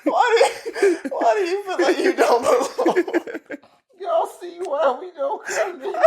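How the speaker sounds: very high, wheezing laughter through the words; crying with laughter. Real, not acted. Host questioning friend about not belonging, crying with laughter. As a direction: squeeze the question out while helpless with laughter. I really got tears in my.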